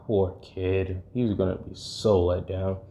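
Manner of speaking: softly murmured mock pity; pitying aside. Fighter privately pities the kid, pitying aside. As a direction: say it low-key, as if to yourself.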